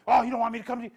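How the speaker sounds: loud mock-indignant comeback; offended protest. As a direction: quote them loudly, taken aback. Acts out offended person's reply.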